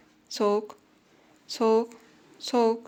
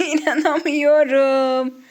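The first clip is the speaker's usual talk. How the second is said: laughing; 3 semitones higher, 7 dB louder, much slower than usual. Real, not acted. Disbelief at the find, laughing.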